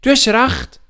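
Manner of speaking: put-on girl happily telling granny she was right; Anna agreeing. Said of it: "sagt sie".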